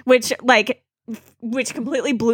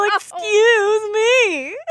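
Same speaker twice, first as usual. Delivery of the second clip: mocking Link; 9 semitones higher, much slower than usual.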